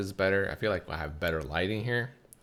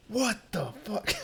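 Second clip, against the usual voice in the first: much higher squeaky exasperated voice; mock lady outburst. Fed-up lady in line, high mock outburst.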